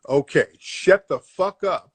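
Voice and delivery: usual speaking voice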